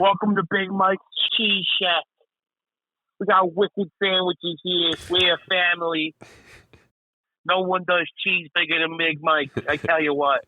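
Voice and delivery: slow, high, hammy Boston ad voice; fake Boston accent. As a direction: sell cheese like a cheesy Boston local ad. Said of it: "fake Boston accent and just do Boston area commercials".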